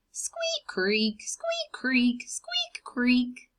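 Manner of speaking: squeaks very high, creaks whispered; squeaky wagon wheels. Acted. Reader imitating the rusty wagon, squeaks high and creaks whispered.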